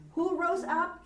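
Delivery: teacher prompting students to answer; prompting question. Real, not acted. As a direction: draw the answer out. Teacher quizzing the class.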